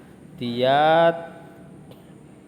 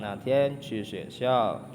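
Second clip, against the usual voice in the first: slow model sentence; slower than usual. Shown as a demonstration. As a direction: slowly read model sentence. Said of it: "teacher models full sentence".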